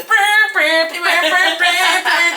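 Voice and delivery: high, loud mock trumpet fanfare; humming fanfare. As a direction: hum the walk-up music. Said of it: "the music was playing in my head".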